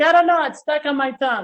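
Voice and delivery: high, loud imitated child; mock panicky kid, tongue caught; kid with tool on tongue. Boy with the dentist's tool on his tongue, high mock-kid wail. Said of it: "I was like".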